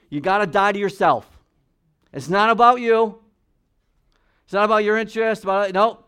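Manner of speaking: full-force preaching. Acted. Pastor preaching to the congregation, loud and emphatic. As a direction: drive the point home to the congregation.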